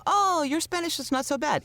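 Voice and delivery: high, chirpy imitation of impressed locals; lightly voiced friendly Spanish speaker; mock praise. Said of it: "people go".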